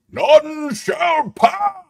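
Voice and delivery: booming wizard, slow and high; slow, very high mock wizard shout. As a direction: draw it out like a gandalf proclamation. Wizard quote after Thomas the Gray.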